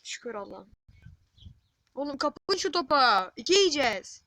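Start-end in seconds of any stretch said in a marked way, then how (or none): 1.9-4.2 alarmed shout. Real, not acted. Gamer warning his team they'll concede twice, alarmed shout. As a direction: stretch the words out in a loud warning.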